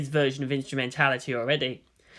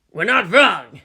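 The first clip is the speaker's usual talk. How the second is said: tense, forceful hiss pushed out loud; angry hiss; 2 semitones higher, 8 dB louder, slower than usual. Acted. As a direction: spit it back at him, cornered. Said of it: "Hayama hissed".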